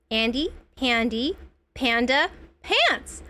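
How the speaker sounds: each of Andy, Pandy, Panda, Pants set apart; slow, careful retry. Real, not acted. Careful retry of tongue-twister name.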